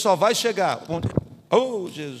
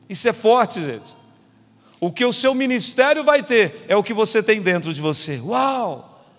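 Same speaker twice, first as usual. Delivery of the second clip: raised, loud sermon delivery; forceful preaching; 3 semitones higher, 6 dB louder than usual. Real, not acted. Pastor tells congregation what their ministry will have, preaching forcefully. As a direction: pound out each parallel phrase.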